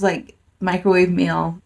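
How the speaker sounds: ordinary delivery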